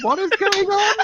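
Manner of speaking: shouting through big laughter; laughing shout. Real, not acted. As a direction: howl the line while cracking up. Erupting at a funny screenshot.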